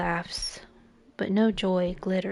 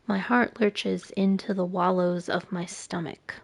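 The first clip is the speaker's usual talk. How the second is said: ordinary talk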